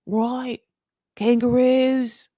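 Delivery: drawn-out mock Australian; amateur Australian accent, words stretched; attempted Australian accent. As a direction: put on a hammy, slow Australian accent. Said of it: "an Australian accent".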